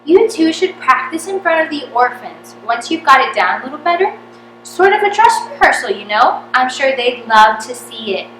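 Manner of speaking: loud, high mock earnest pitch; Eric's sly suggestion. A narrator's reading. Eric pushing the orphan rehearsal idea, loud and sly. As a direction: sell it with fake sincerity.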